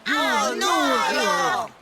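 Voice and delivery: loud groaning no against the singing idea; loud protest. Acted. Refusing the singing idea, loud and groaning. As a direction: protest loudly, fed up.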